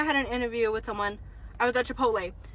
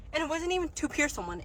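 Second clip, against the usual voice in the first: usual speaking voice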